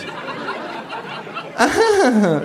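high mocking laugh back; kangaroo laugh. Kangaroo laughs back at the hostess, mocking. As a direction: laugh along, mimicking her fake laugh. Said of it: "lacht auch das Känguru".

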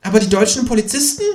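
high, quick creature voice; interjecting kangaroo. A narrator's reading. Kangaroo interrupting about the German policemen, quick and high. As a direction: jump in with a quick high objection. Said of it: "wirft das Känguru ein".

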